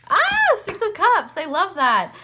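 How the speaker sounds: high bright delighted squeal; thrilled at the card; excited. Real, not acted. Tarot card reveal delight.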